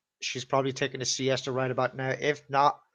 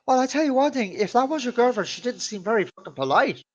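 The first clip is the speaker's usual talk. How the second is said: higher, louder imitation of an offended mother; mock indignant mom; 10 semitones higher, 4 dB louder than usual. Mother to son about rude girlfriend, mock indignant. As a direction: act out a mom's loud complaint.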